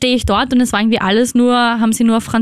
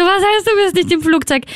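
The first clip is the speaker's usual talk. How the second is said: high, disbelieving outburst, close to tears and laughing; half furious, half about to cry; half angry, half tearful; 8 semitones higher than usual. Retold or imitated. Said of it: "So halb aggressiv, halb Tränen, halb Lachen".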